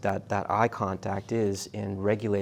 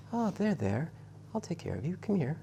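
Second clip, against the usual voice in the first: put-on soft, higher, meek cooing at a crying baby; meek little tone; 7 semitones higher, 7 dB quieter than usual. Shown as a demonstration. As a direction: coo timidly as if afraid to upset the infant. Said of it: "a meek little tone like that".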